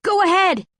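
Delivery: high loud call up the chute; shout. A narrator's reading. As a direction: shout up to the others.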